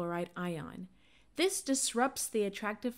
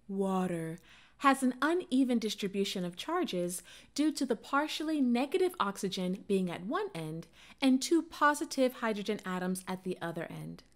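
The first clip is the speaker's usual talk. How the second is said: usual speaking voice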